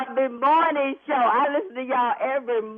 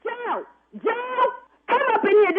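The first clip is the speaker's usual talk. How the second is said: calling out; 10 semitones higher than usual. Real, not acted. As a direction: yell for someone in another room. Calling someone in the house.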